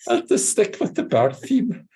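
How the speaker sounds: high, laughing cartoon dog voice; doggy voice. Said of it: "he said in a doggy voice".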